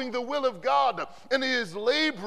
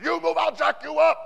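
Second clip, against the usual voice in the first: loud barked threat, a bit higher; drill sergeant threat; 5 semitones higher, 9 dB louder than usual. Retold or imitated. Drill sergeant warning a frozen recruit, loud barked threat. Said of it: "and I said".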